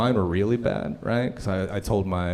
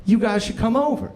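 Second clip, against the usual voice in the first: high, eager and naive; innocent eager invite; 12 semitones higher, 4 dB louder than usual. Retold or imitated. Sweet coworker inviting the couple over, high and eager. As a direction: pitch it up and mean it completely.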